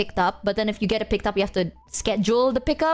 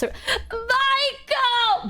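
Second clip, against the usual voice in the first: anguished cry; 12 semitones higher than usual. Real, not acted. Venting about coworker's wasted effort.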